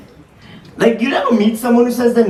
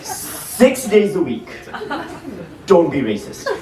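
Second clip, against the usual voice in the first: slow solemn advice; 2 semitones lower, slower than usual. Acted. Repeats the routine as a punchline.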